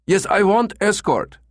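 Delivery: put-on very high, pleading German-accented voice. Caller pretends to want an escort, high German accent. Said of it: "in his German accent".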